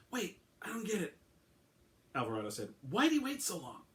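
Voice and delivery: confused detective. A narrator's reading. Alvarado voiced in audiobook.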